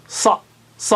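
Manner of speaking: صا said thick from the back; teacher drilling saad; heavy saad. Shown as a demonstration. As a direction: repeat صا heavy and full. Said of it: "اور صاد یہاں پر ہے".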